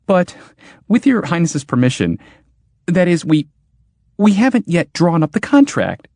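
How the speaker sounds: high, halting nervous voice; stammering. Acted. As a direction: stammer it out in fear. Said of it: "stammered Churl".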